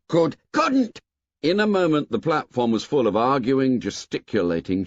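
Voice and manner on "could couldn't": put-on boy's retort. A narrator's reading.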